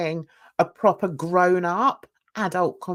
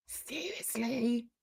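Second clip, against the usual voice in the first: much softer and slow, dropped flat under the breath; deadpan disbelief; 3 semitones higher, 12 dB quieter, slower than usual. Real, not acted. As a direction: let Seriously? sink quietly and slowly. Repeats the word in disbelief.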